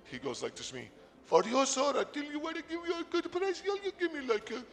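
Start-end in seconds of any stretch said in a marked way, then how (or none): 1.2-4.6 high, thin put-on Spanish accent for a tiny street seller; hammed-up high Mexican street vendor accent; bad Spanish accent. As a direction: squeak it out in a hammy Spanish accent. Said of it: "I can't do a Spanish guy".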